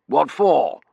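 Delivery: colonel's impatient bark; blustering colonel. A narrator's reading. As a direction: demand a reason loudly. Colonel reacting to the news.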